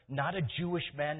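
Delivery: usual speaking voice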